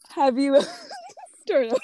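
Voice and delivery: shouting through laughter; laughing. Real, not acted. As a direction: laugh out the question in disbelief. Laughing disbelief at the claim.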